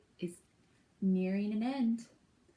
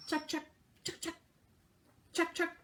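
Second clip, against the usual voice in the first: thrasher chuck calls; 8 semitones higher, 4 dB quieter, faster than usual. Shown as a demonstration. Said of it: "Let's try".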